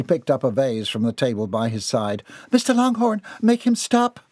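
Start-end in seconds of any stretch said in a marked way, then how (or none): 2.5-4.2 high, loud cry for help. A narrator's reading. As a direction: cry out to your husband, pitched way up. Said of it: "Missus Longhorn cried out".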